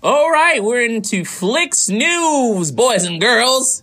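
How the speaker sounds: high, slow showman announcer. Acted. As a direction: boom it out like a game show host. Hosting intro to news segment.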